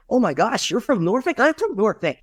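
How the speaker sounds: weirdly excited stranger gushing at a passerby; overexcited stranger. Acted. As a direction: act way too thrilled over a shared hometown. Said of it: "This guy's weird".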